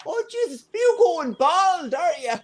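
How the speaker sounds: put-on high teasing mother voice; mocking mother. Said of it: "She turned to my brother and go".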